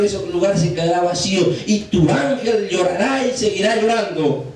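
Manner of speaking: high-pitched, driving sermon delivery; fervent preaching. Real, not acted. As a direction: press the warning on the congregation. Preaches at full force.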